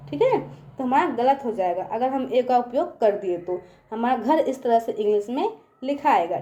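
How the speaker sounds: ordinary talk, nothing marked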